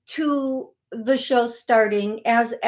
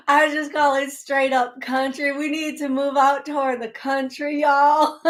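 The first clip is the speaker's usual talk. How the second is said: hammed-up Southern twang, pitched higher; bad Southern accent; 4 semitones higher than usual. Host joking to callers about moving to the country, bad Southern accent. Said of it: "my bad Southern accent".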